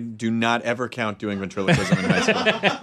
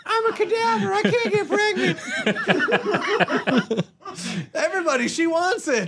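very high mock ventriloquist falsetto; dead girl dummy. Host mocking the prom date as a talking corpse, high puppet voice. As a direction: squeal it as a hammed-up puppet corpse. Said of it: "a dead girl that you made to talk".

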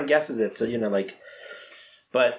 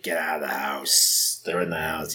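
ominous horror voice; 8 semitones lower, 4 dB quieter than usual. Retold or imitated. Quoting the horror movie line.

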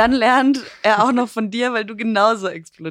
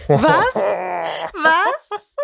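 shocked what. Real, not acted.

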